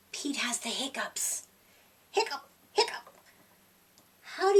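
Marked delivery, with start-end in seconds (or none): 0.1-1.4 whispered, hushed narration; breathy storyteller whisper. A narrator's reading. 2.1-3.2 high, quick hiccup sounds; little cat hiccups; squeaky hiccups. Acted. Pete hiccups in a voice.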